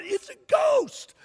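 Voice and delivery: high slow mock shriek of fright; scared scream. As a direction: shriek it like you see a ghost. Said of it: "They even screamed out".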